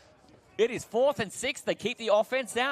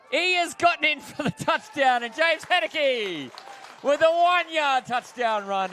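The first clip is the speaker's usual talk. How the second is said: exclaimed, excited confirmation; touchdown call; 7 semitones higher, 5 dB louder than usual. Real, not acted. Commentator confirms a doubtful one-yard touchdown, excited call. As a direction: raise the voice as the score is confirmed.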